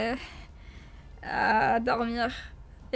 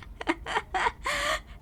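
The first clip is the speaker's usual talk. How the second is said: high giggle; 11 semitones higher, 5 dB quieter than usual. Real, not acted. Laughing at Frenchiest accent.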